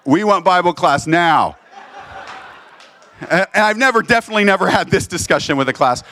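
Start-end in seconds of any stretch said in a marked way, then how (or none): 0.0-1.6 eager protest. Retold or imitated. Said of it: "they're all like".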